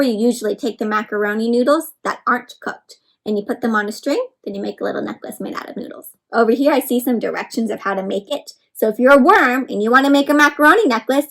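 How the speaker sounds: usual speaking voice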